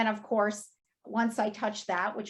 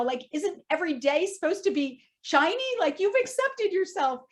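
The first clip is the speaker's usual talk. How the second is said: high, anxious self-scolding; higher and louder, scolding herself in a panic; judging inner voice; 6 semitones higher, 4 dB louder than usual. Retold or imitated. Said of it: "I went immediately into judging".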